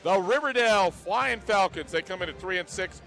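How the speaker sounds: loud, high broadcast welcome; radio sports announcer. Acted. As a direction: open the game show with energy. Opens the game broadcast.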